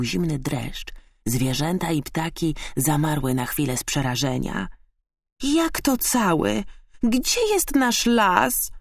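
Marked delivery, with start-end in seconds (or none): 5.4-8.7 high, drawn-out dismayed cry; alarmed cry. A narrator's reading. Hedgehog learning the forest is gone, alarmed cry. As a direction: cry out high, without much volume. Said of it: "krzyknął Igo".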